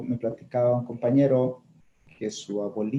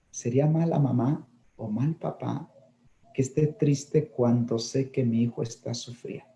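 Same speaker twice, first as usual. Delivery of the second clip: normal talking voice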